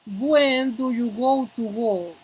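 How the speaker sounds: slow, drawn-out example question; deliberate teacher's demonstration; slow model pronunciation. Shown as a demonstration. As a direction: stretch the words out for learners.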